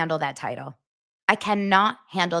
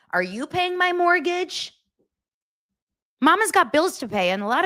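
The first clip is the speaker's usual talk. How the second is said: sarcastic retort; 10 semitones higher than usual. Acted. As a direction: challenge them in mock disbelief, pitched way up. Sarcastic retort to complaining followers.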